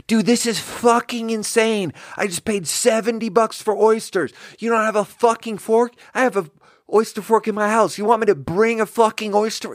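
high-pitched outraged yelling; imitating a screaming customer. Retelling the irate oyster customer yelling at the server, high-pitched and outraged. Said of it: "he's just screaming at me like".

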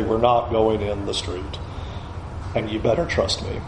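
low, soft parental ruling; firm parent. Acted. Parent to a small child about the street, quietly firm. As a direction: keep it low and quiet, no arguing. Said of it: "what we do as parents to our kids".

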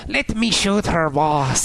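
mock weird little-guy accent; Ren-like weird voice. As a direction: drag it out like a cartoon sidekick. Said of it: "he's some weird voice ... He sounds like Ren".

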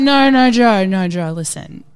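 loud, insistent pleading; loud drunken protest. Retold or imitated. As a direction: shout him down, half laughing. Drunk pleading with Joe Rogan.

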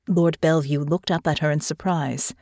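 ordinary delivery, nothing marked

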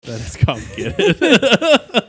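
high, slow, loud mock gravity; mock dramatic. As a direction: stretch it out like a soap opera. Echoing co-host's remark mockingly.

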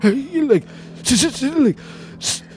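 high, strained stammer; terrified stuttering. Retold or imitated. Re-enacting his phone call to girlfriend, frightened high stutter. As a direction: act too scared to get the words out. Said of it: "I was, like, stuttering".

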